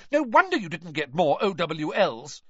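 loud, high-pitched scolding; shrieking. A narrator's reading. Mother blaming the twins for poor results, shrieking. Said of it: "she shrieked".

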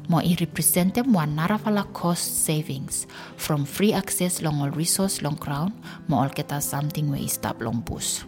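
ordinary talk, nothing marked

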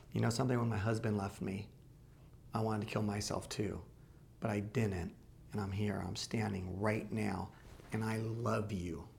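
a hint of a tender plea, lightly voiced; tender, understated. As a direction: soothe a desperate man quietly. Retelling her tender plea to gunman.

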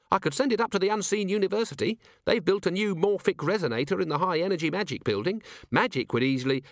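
high, quick underling's voice; Cheery, eager dwarf. A narrator's reading. Cheery offers Vimes a magical test, high and eager. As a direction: pitch it up, eager to help.

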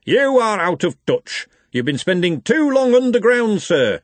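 high, emphatic, indignant telling-off; stern. A narrator's reading. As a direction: scold a colleague sternly, stretching the words. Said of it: "said the lecturer in recent runes sternly".

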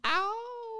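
very high squeal and yelp. Retold or imitated.

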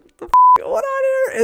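high, loud panicked outburst; mock panic. Woman wobbling on a falling ladder, mock panic. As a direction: blurt it out as if teetering off balance.

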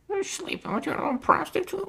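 very high cartoon duck. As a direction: imitate a prank caller talking like a duck. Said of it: "somebody who talked like a duck".

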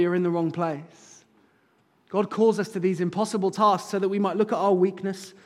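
ordinary talk, nothing marked

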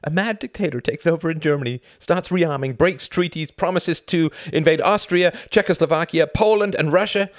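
loud, high, climbing outrage; furious rant. Acted. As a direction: rage at the world's blindness.

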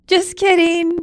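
high, loud fake cheery punchline; mock just kidding. Wife mimicking husband's prank reveal, high and loud. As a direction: toss it off brightly as a cruel joke. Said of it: "Just like you don't joke".

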